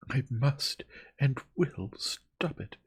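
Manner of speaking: high, loud, slow and emphatic; vehement determination. A narrator's reading. Young woman refusing to be silenced, vehement. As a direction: drive each word home in anger. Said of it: "in the rage that possessed her".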